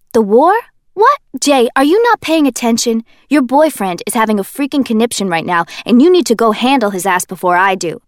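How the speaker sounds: put-on loud exasperated young man; Kenji, exasperated. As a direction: scold her for not paying attention.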